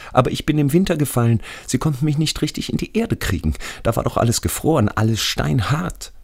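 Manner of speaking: hohe, eindringliche Stimme des Gefallenen; ängstlicher toter Schulfreund. Acted. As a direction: als toter Freund erklären, deutlich höher. Radi tells of his death. (hoch, drängend und klagend)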